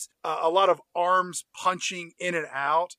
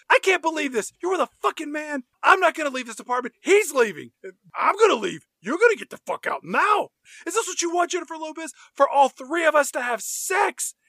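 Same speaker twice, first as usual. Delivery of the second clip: mock freaked out; 9 semitones higher, 4 dB louder than usual. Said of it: "and she says".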